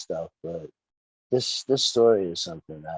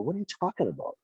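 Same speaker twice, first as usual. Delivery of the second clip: high, softer baffled question; retold incredulity; 8 semitones higher, 6 dB quieter, faster than usual. Wrestler recalling being told to leave, incredulous. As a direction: replay your disbelieving reply. Retelling his reply to dismissal.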